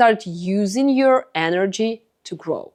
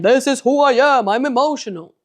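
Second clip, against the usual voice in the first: mock angry outburst; 3 semitones higher than usual. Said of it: "you're screaming at people saying".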